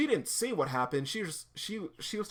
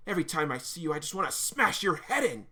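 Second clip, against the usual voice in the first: louder, punchy acted threat; angry threat; 2 semitones higher, 6 dB louder than usual. Fed-up girl threatening the guys, angry. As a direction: hit the threat hard, fed up.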